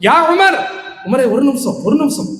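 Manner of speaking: high-pitched call after someone leaving. Retold or imitated. The Prophet calls Umar back for a minute, calling out. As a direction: call him back, acted in a retelling. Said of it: "ரசூலுல்லாஹ் கூப்பிடுறாங்க".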